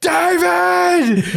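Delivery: high-pitched retold yell; mock scream. Imitating two hosts trying to halt the guest, mock scream. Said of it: "He's screaming".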